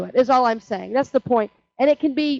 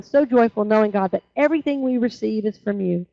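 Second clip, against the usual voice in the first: usual speaking voice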